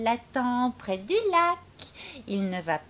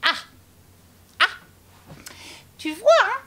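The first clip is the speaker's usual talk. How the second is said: cocky mouse; 11 semitones higher, 6 dB louder than usual. Acted. Mouse brags.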